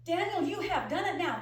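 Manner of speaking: high, loud scolding; angry yell. Retold or imitated. Aunt yelling at Daniel over the snakes, angry. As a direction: let the anger burst out. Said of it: "screaming and yelling".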